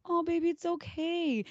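put-on high, slow baby-talk voice; imitated mother comforting a toddler; soothing mom. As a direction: coo it to a little one. Voices mom soothing little boy.

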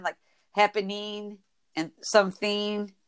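normal talking voice

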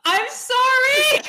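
high, loud, slow wail; wailing apology. Pretend. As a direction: drag out a mock sob.

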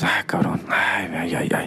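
soft unvoiced sigh of dismay; whispered dismay. Dad whispers his dismay at his hungover son. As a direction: whisper it, shaking your head.

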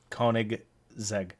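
Koenigsegg split slowly as KOH-nig, ZEG; G in the middle. Shown as a demonstration. Correcting a co-host's pronunciation, Koenigsegg slowly as KOH-nig, ZEG. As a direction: show the G in Koenigsegg, slowly, in two parts. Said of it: "There's a G in the middle".

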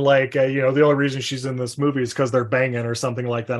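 usual speaking voice

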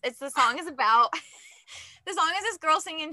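talking through laughter; can't stop chuckling while explaining; laughing through the words. Real, not acted. Laughing while describing song.

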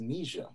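Nisha said with a long ee, like the end of amnesia; corrected name pronunciation. Shown as a demonstration. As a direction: say "Nisha" as NEE-zha. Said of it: "found out it's pronounced Nisha".

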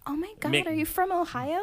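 high-pitched mock relief; playfully overwrought excitement; retold excitement. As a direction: act out finding a fellow Ohioan, pitch jumping up. Retells relief at hearing familiar accent.

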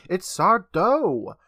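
put-on "Sardo" stretched out, accent on the "do"; stress on the last syllable. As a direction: draw out the end of "Sardo" theatrically. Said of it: "No Mister accent on the dough".

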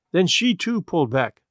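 usual speaking voice

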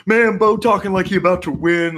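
high, loud teasing jab; mocking. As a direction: rib him like a heckling fan. Retelling people mocking Bo's interview.

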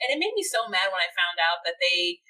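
usual speaking voice

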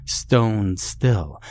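ordinary delivery, nothing marked

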